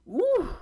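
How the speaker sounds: high-pitched "woo"; high whoop. Real, not acted. Balking at three thousand dollars, high whoop. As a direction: let out one high whoop. Reacting to a big price.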